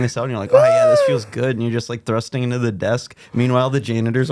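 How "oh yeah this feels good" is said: mock delight. Imagined scene of a friend.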